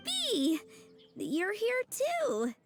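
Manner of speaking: high, delighted greeting, slowing at the end; surprised greeting. Acted. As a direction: greet a friend you didn't expect. Greeting a friend who turns up.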